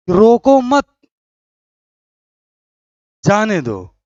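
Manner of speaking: loud "रोको मत", very long pause, then "जाने दो" falling; pause after मत. Shown as a demonstration. Teacher demonstrates the third sentence, long pause after मत. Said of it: "के बाद काम आ लो".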